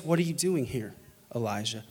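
hushed question, sinking low on the name; God's gentle whisper. Acted. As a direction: ask softly, letting the voice drop away. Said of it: "then he heard God's voice".

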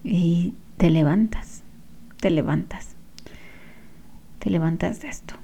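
hushed breathy urging; whisper. Acted. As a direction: whisper it gently like a secret encouragement. Encouraging whisper about getting up.

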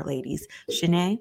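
normal talking voice

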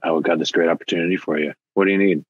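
a slick recruiter selling the offer; mock salesy clinic voice. Clinic staff pitching to the donor, mock slick.

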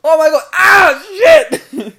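high, loud startled cry; shocked shout. Pretend. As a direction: yelp it out in shock. Acted shocked reaction in story.

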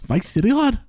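high jokey northern voice; mock Yorkshire lad. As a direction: tease him with a squeaky Yorkshire lad voice. Mocking accent banter about GTA.

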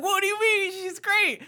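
high-pitched imitation of a friend's surprise; baffled protest. Friend defending Moira by message, baffled protest. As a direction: push the pitch up in disbelief. Retold baffled reply from Tyson.

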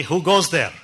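lightly voiced booming bishop; a touch of thunder in a bishop's challenge; thunderous British bishop. Dad imitating the bishop answering a knock, mildly thunderous. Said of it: "in his thunderous voice".

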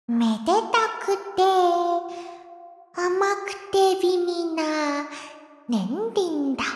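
slow, soft haiku reading; poem recitation. Acted. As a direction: recite the senryu gently. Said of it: "今日のスイーツ川柳を一句".

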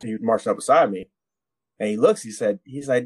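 ordinary talk

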